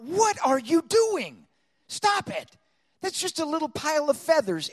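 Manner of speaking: high, loud self-rebuke; scolding himself. Retold or imitated. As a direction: give yourself an indignant talking-to. Scolding himself in retold thought.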